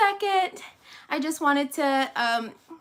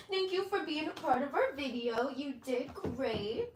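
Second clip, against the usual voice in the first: tender praise; 10 dB quieter than usual. Real, not acted. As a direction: gently thank the pet. Softly praising the dog.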